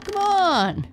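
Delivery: high, loud friendly call; bright-spirited storybook calling, high and loud; kindly call. A narrator's reading. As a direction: call out to coax a timid friend. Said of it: "Spike called to Boo kindly".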